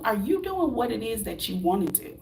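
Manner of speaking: usual speaking voice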